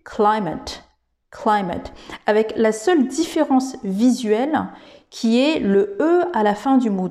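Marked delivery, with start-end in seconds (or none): 0.1-1.9 climate said the English way, klai-mate. Shown as a demonstration. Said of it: "en anglais qui va se prononcer climate".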